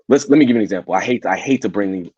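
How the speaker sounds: usual speaking voice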